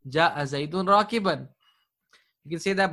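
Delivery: usual speaking voice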